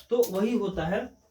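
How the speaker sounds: usual speaking voice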